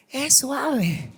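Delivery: softly spoken, with a small laugh; gentle, soft-voiced. Shown as a demonstration. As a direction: say it gently and quietly. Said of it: "tan suave la voz de Dios".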